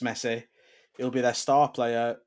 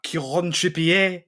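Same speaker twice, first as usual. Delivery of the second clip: "Kieran Trippier" slow, higher and louder, Frenchified as trip-ee-ay; imitating a pompous pundit; mocking Match of the Day pronunciation; mock French commentator; 5 semitones higher, 6 dB louder, slower than usual. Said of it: "they'd be like".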